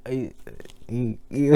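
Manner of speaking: normal talking voice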